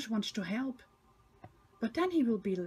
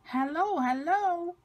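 high, slightly louder cheery greeting; puffing hello; 7 semitones higher, 3 dB louder than usual. A narrator's reading. Thomas greeting the children cheerfully. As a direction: puff out a bright hello. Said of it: "he puffs".

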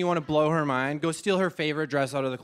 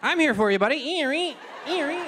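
much higher, squeaky little machine voice; squeaky foam dispenser; 10 semitones higher than usual. Retold or imitated. Hand foam dispenser cheerfully squeaking at the comedian. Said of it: "hand foam is like".

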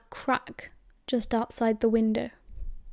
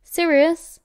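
raised, higher call from the hall; loud call of a name; 6 semitones higher, 7 dB louder than usual. A narrator's reading. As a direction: summon him from another room. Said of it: "called from the hall".

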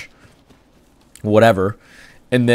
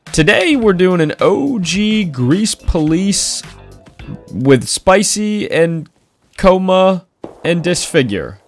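hype announcer; 8 semitones higher, slower than usual. Real, not acted. Excited intro announcement.

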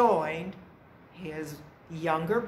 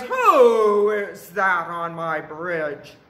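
loud, drawn-out troll demand; challenging troll; 10 dB louder, slower than usual. A narrator's reading. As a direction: boom it out slowly like a bridge troll.

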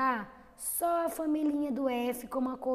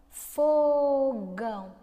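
Fogão slowly and louder, stretching fo; drawn-out demo word; 6 dB louder, much slower than usual. Shown as a demonstration. Teacher drawing out Fogão for the children. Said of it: "E fogão?".

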